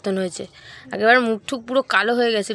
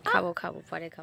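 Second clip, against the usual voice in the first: quiet; 4 semitones lower, 11 dB quieter than usual. Real, not acted. Quiet aside to mother.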